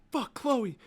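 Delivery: imitating sudden alarm, high and loud; loud, high retold shout of realization; alarmed outburst. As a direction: jolt awake and cry out in mock alarm. Acting Tyler waking in alarm.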